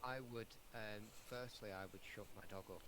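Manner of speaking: muffled, hand over mouth; very quiet behind a hand over mouth. Real, not acted. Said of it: "You've got your hand over your mouth".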